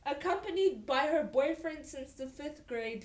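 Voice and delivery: high, loud incredulous reread; loud disbelief. Real, not acted. Reader repeating the fifth grade line, loudly incredulous. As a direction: read it out loudly, as if you can't believe it.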